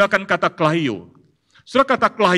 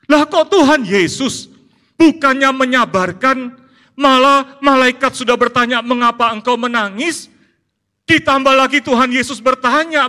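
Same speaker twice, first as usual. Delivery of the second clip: high, loud, impassioned sermon; fiery pulpit preaching; preaching at full force; 11 semitones higher, 8 dB louder than usual. Acted. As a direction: drive the point home to the congregation. Preaching peak.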